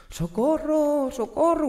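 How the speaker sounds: high, slow mock screams for help; trapped people wailing from the windows; cries for help. People in the burning building, high wailing screams.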